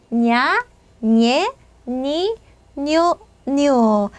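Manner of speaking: 냐, 녜, 니, 뉴 one by one with a palatal ny sound; ñ pronunciation. Shown as a demonstration. Teacher showing students ñ, each syllable said apart with ny. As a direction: model each ñ syllable separately as 냐, 녜, 니, 뉴. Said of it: "자, 얘 발음은 어떻게 되냐면".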